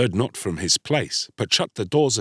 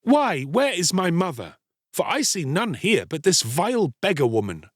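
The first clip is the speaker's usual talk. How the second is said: put-on higher-pitched scornful boy; 8 semitones higher than usual. A narrator's reading. Boy disowning a beggar woman as his mother, scornful. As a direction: lift the voice in disdain. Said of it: "he laughed scornfully and said".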